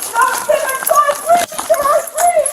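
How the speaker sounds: high, drawn-out begging; desperate pleading. Real, not acted. Detainee begs officer about the dog, desperate high plea.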